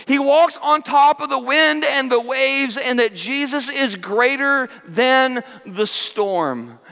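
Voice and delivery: preaching proclamation. Acted. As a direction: proclaim it over the storm. Sermon climb to proclamation.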